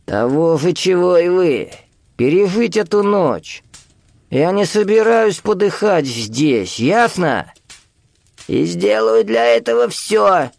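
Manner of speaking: high, slow, insistent reply; old man's high-pitched, drawn-out vehemence; desperate old man. A narrator's reading. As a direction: drag out each declaration in a strained high voice. Старик отчаянно отвечает из укрытия.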